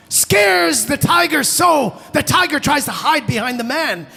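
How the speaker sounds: high, loud, dramatic storytelling; excited panic narration. A narrator's reading. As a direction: burst out with the big scare.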